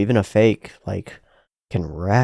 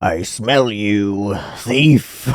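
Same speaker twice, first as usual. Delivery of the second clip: slow, low dragon voice; Smaug voice; 5 semitones lower, 3 dB quieter, much slower than usual. Retold or imitated. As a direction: growl it slowly like an old dragon. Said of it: "I just want it to sound like Smaug".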